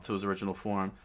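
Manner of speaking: plain reading voice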